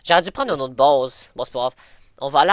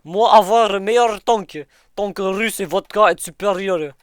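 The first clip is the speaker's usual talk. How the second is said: put-on higher, louder Russian accent; boastful broken Russian general; 5 semitones higher, 3 dB louder than usual. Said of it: "prends ton accent russe".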